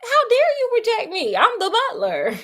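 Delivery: high-pitched indignant huff, half playing; mock outraged butler. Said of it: "he mad".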